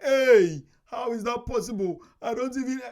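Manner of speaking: loud, high-pitched indignant objection; mock shouting. Viewer challenging the speaker's savings figure, mock shouting. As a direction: imitate an outraged viewer shouting in protest. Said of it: "before you come shouting like".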